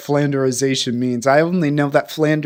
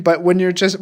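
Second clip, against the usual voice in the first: ordinary delivery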